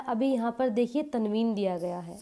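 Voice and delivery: ordinary delivery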